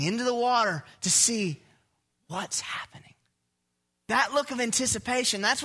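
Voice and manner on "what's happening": soft suspenseful whisper. Acted. Preacher voicing onlookers at the pier rail, whispered. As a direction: drop to a whisper as everyone leans in.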